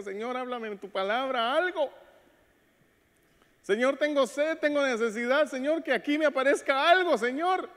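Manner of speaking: desperate prayer to the Lord; pleading prayer. Acted. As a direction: beg the Lord for a word, rising in intensity.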